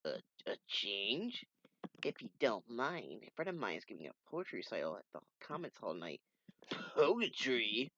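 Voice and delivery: usual speaking voice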